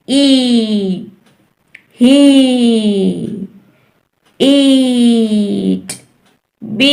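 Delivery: "ee" held long in "ई, ही"; long E sound. Shown as a demonstration. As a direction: stretch the vowel of "ईट" for a learner. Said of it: "long sound है".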